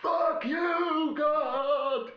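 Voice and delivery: high, full-throated yelling at God; screaming curses, pitch way up; retold drunken shout. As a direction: bellow it at the sky like a raving fan. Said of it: "he was still going".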